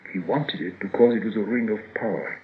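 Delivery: usual speaking voice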